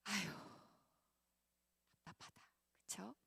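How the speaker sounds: soft whispered sigh of frustration; quiet exasperated whisper to the crowd; frustrated whisper. Real, not acted. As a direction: breathe it out in exasperation. Sighing aside to congregation.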